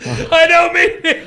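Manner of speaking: very high, drawn-out plea; mock wailing. Apologizing to a co-host, high mock wail. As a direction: stretch it into a squeal. Repeats exaggerated retraction.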